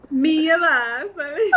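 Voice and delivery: laughing, stretched Mi Vasali with an Italian ring; slow mock-Italian Mi Vasali. Shown as a demonstration. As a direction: give it an Italian lilt through laughter. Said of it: "It all sounds Italian no matter what".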